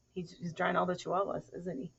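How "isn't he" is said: ordinary delivery, nothing marked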